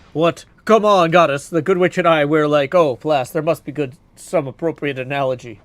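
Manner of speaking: loud stammering warrior voice; flustered Odysseus. Acted. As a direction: caught off guard, fumbling for words loudly.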